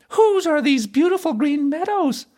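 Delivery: very high princess voice, questioning. A narrator's reading. Princess asking the fiddler who owns the meadows, high-voiced. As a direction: ask in a high-pitched character voice.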